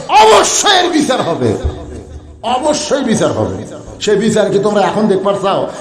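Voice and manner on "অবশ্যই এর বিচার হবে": high, ringing verdict; forceful proclamation. Retold or imitated. Umar promising judgment to the crowd, high forceful proclamation. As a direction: proclaim it high, falling at the end. Retold Umar's verdict, proclaimed then repeated lower.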